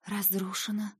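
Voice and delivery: soft disbelieving repeat; stunned echo. Acted. Heroine repeating the news in disbelief, hushed. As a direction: echo the word numbly. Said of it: "повторила я".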